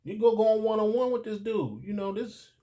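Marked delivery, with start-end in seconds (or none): none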